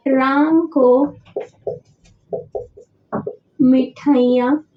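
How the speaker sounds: slow, word by word with long pauses; unhurried teacher spelling out an example sentence; slow dictation. Shown as a demonstration. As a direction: dictate each word slowly for the board to copy. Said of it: "जैसे सीता ने राम को मिठाइयाँ दी".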